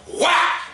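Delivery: loud, high "whack"; explosive comic smack sound; imitated punch noise. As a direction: shout the sound of a sudden punch. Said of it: "And he went".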